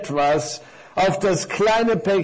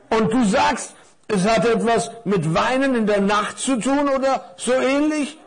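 puzzled scarf; 7 semitones higher, 3 dB louder than usual. Acted.

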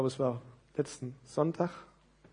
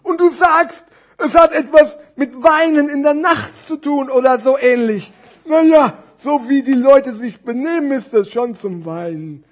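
high, loud character voice, dropping at the end; storybook puppet, strongly projected; muddled fat scarf; 11 semitones higher, 15 dB louder than usual. Acted. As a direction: muse aloud with exaggerated puzzlement. Scarf misunderstands the name.

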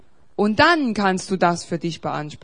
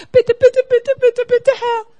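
high, loud whiny pleading; a hammed-up, high, loud plea to God; mock begging; 13 semitones higher, 10 dB louder than usual. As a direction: beg like a nagging child, much higher and louder. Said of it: "die Gerechtigkeit aus Glauben sagt".

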